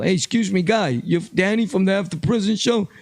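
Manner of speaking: a hint of a threatening tough guy; mock street confrontation. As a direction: voice Joe squaring up, rising on the car line. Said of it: "you wanna talk about Joe".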